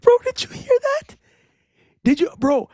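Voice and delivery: high and loud, still laughing; can't believe what he heard; giggling incredulous bro; laughing disbelief. Real, not acted.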